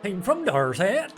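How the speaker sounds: high, slow, exaggerated Dorset accent; mock Dorset accent. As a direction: drag it out in a put-on Dorset accent. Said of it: "try and figure out Adam's".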